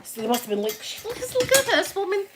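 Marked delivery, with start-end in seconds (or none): 1.0-2.2 high put-on voice of gawking ambulance crew; mocking onlookers. Ambulance crew gawk at the drugged patient, high mock voice. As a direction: play the crew pointing her out, higher.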